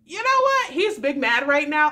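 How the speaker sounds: high, loud delighted remark; high amused exclamation. Retold or imitated. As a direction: crow it with glee. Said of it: "I was just like".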